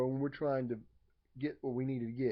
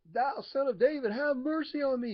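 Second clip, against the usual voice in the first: much higher and louder, full-throated holler; 12 semitones higher, 8 dB louder than usual. Retold or imitated. Blind beggar hollers louder to Jesus over the crowd, full yell. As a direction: yell it with everything in your body. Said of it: "He hollers it even louder".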